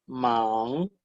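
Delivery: "man" drawn out, rising at the end; second tone. Shown as a demonstration. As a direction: hum the ng of "man" as the pitch climbs. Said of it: "en el segundo tono".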